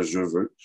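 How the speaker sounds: "je veux" is cheveu with a voiced j; CH becomes J. Shown as a demonstration. Lecturer giving example, cheveu said "je veux". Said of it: "le ch, devient un J".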